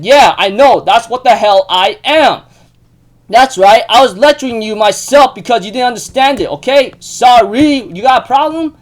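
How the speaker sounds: loud, high, heated mock confession; acted defiant outburst. Yuki confesses mid-fight to Manabe, acted angry defiance. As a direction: shout it back as a dare.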